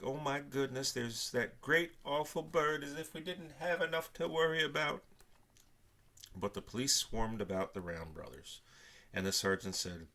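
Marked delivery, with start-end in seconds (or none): 0.0-5.0 higher, louder flustered fretting; mother in a fluster over the awful bird; frantic. A narrator's reading. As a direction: fret in a flustered rush. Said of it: "nearly frantic".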